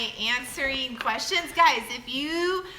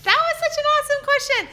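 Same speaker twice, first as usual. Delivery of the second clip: excited praise; 5 dB louder, much faster than usual. Real, not acted. Praising a child viewer's question.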